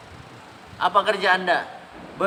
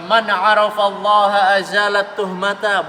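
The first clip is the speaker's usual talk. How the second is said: slow, chanted Arabic quotation; preacher reciting an Arabic maxim, slow and measured; Arabic recitation; 5 dB louder than usual. Acted. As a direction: intone the saying like scripture. Quoting Arabic saying in sermon.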